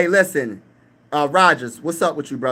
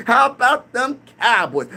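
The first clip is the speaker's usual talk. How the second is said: slow, higher mock Skip Bayless catchphrase; Skip Bayless impression; 7 semitones higher, slower than usual. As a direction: lightly imitate a TV pundit's slow, drawn-out catchphrase. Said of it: "Skip Bayless, I know you say".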